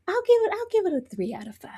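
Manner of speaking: a hushed, secretive score; whispered rating. Real, not acted. Host gives her show rating, whispered. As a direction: whisper the number like a confession.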